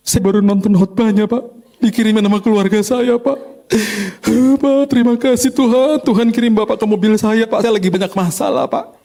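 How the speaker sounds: high, loud tearful pleading driver; crying driver. Retold or imitated. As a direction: sob out the thanks as the weeping driver. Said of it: "Terus dia nangis". (sopir menangis dengan suara tinggi dan keras)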